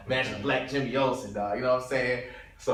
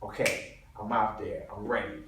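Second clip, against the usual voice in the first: usual speaking voice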